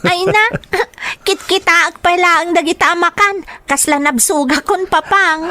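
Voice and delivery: put-on a little girl fussing over too much food; child voice. Child complains to father about the food. Child full after eating.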